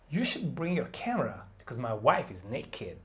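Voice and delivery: bring, camera, wife and naked each hit harder; content word stress demo. Shown as a demonstration. Teacher demonstrating stress to students, content words punched. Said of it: "Did you hear which words I stressed?".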